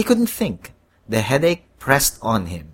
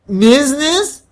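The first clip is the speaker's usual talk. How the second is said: high, loud congested question; stuffy-nosed query; 13 semitones higher, 7 dB louder than usual. A narrator's reading. Congested man queries the devil's word, stuffy-nosed. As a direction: echo it through a blocked nose. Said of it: "with a stuffy nose".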